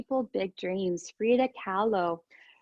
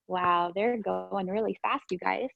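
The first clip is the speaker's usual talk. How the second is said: usual speaking voice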